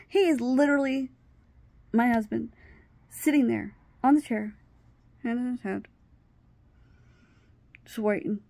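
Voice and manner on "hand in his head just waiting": ordinary delivery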